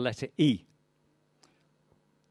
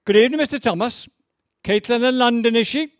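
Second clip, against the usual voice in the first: much higher, loud and quick greeting; Welsh barman; 11 semitones higher, 9 dB louder than usual. Acted. Barman greeting Mister Thomas, high and chirpy. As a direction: sing out a chirpy hello.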